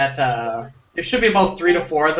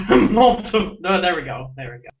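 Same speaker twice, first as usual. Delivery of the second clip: ordinary delivery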